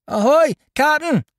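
very high, loud piercing hail; shrill cry. A narrator's reading. Boy Dickon hailing the captain from the companionway, shrill. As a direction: shriek out for the captain. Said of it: "I caught the shrill voice".

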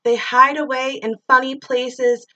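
plain reading voice

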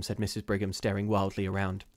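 usual speaking voice

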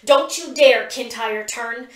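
loud then slow stern warning; stern snarl. A narrator's reading. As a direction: scold him like a guilty child. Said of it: "Pip snarls".

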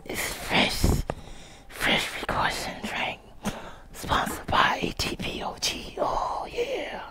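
whispered mock sponsor plug. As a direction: breathe it like a secret ad.

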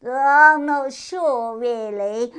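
high, very loud and very slow; vague hare. A narrator's reading. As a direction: muse aloud without a care.

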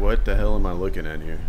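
ordinary talk, nothing marked

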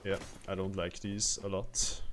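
ordinary talk, nothing marked

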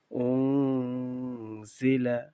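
long-held nasal hum in উংজিলা; ghunnah with ikhfa. Shown as a demonstration. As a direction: stretch the উং of উংজিলা through the nose. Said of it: "গুণনার সাথে আমাদের গোপন করতে হবে". (উংজিলা-তে গুন্নার সাথে ইখফা)

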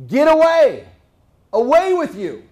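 loud, high booming command; God's thunderous order to leave; commanding shout as God. Acted. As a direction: bark it like an angry God. God voiced driving people away.

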